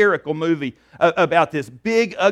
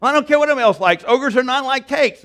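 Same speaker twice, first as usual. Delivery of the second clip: high, loud acted irritation; exasperated retort; 7 semitones higher, 5 dB louder than usual. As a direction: snap back loudly, out of patience. Acted Shrek snapping back at Donkey.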